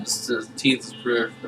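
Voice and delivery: normal talking voice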